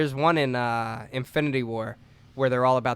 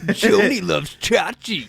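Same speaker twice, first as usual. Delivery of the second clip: slow, louder quoted line; slow movie quote; 6 semitones higher, 5 dB louder, slower than usual. Retold or imitated. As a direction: drag the line out like a catchphrase.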